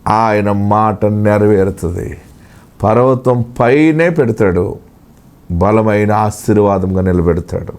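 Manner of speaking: hushed prophetic consolation; prophetic word. Acted. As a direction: speak for God, low and close. God speaking to the listener.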